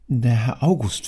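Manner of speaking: usual speaking voice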